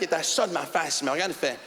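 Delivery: ordinary talk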